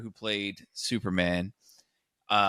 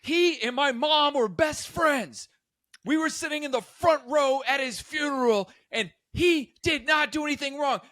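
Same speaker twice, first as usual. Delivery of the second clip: high passionate coworker; 14 semitones higher than usual. Retold or imitated. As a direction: plead Reeves' case in a high voice. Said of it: "And Shayna was like".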